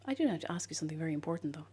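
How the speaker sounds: fast secretive whisper. Pretend. Whispered teaser before a question.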